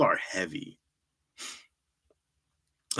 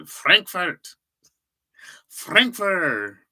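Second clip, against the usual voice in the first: louder mock German accent on Frankfurt; 8 semitones higher, 5 dB louder, much slower than usual. Grasping for German words, he booms Frankfurt in a hammed-up German accent. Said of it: "I don't know any German".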